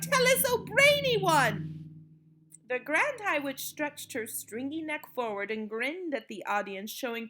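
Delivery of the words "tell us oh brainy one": fawning witches. A narrator's reading. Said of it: "they cried".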